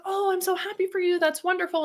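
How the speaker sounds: high, fast fake-cheerful; sugary feigned delight; brightly pretending to be happy for her; mock delighted. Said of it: "And I was like".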